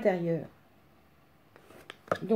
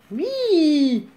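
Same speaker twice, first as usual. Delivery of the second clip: bright triumphant yes; excited cheer; 6 semitones higher, 7 dB louder than usual. Real, not acted. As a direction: cheer the small win out loud. Stitch finally goes through hole.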